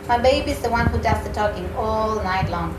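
ordinary delivery

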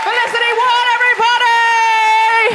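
high, stretched-out announcer cheer; compere's applause call. Real, not acted. Host cues applause for the comedian, rousing announcement. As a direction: sing out the act's name to the crowd.

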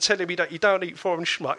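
normal talking voice

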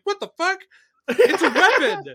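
incredulous high mock outburst, slowing at the end; shocked agents. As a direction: play the stunned agents, half laughing. Agents reacting to nail gun.

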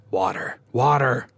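sharp repeated demand; hissed demand. A narrator's reading. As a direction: build from restrained to forceful. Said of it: "the first hissed".

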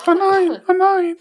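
high, loud "oh no"; shrill concern for a choking friend; alarmed. Real, not acted. (erschrocken, laut und hoch)

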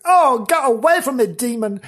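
high, loud hammed-up terror; mock-terrified high scream; screaming chased victim. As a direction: shriek to drive the demon away. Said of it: "She's been screaming and being chased".

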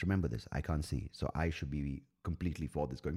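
usual speaking voice

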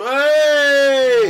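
loud high shout of "Hey!", mimicking a crowd; crowd cheer. Comedian imitating his gig crowd cheering at Lenny Henry's name, loud shout. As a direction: whoop it like a gig audience cheering. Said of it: "and people do".

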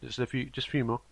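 talking between bites; chewing while talking. Real, not acted. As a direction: mouth full of chocolate. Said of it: "Do you hear me eating it?".

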